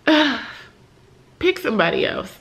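half-joking brush-off, pushed out loud; mock exasperated groan. Retelling her reply to people asking for prayer, mock exasperated. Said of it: "I be like".

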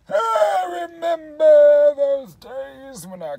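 put-on high, loud and very slow old man; old grandfather. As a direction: holler like a creaky grandpa remembering. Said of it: "I could be a grandfather".

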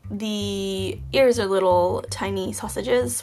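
ordinary talk

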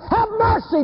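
high-pitched cry for mercy. Acted. Man raising his hands to pray, crying out for mercy. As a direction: cry out desperately, pitched right up. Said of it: "he's about to raise his hands and cry".

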